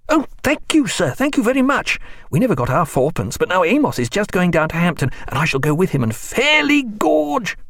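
lightly voiced delighted boy, high; a boy thrilled by a tip; grateful boy. A narrator's reading. George thanking Stephen for the coin, delighted.

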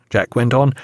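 ordinary delivery

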